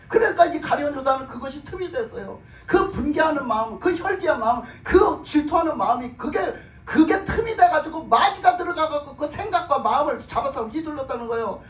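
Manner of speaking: urgent pulpit explanation; forceful preaching. Real, not acted. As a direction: pile up each point with mounting insistence.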